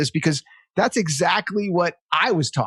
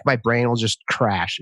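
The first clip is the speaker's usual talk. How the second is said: ordinary delivery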